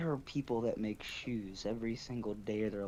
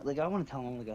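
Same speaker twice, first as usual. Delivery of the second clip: usual speaking voice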